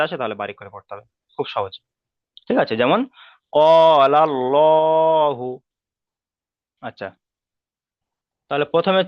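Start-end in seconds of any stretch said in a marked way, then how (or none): 3.6-5.5 কলাল্লাহু chanted very slowly and louder, vowels held; full, heavy recitation. Shown as a demonstration. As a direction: draw out কলাল্লাহু with a thick 'lo'. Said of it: "পুর করে পড়তে হবে".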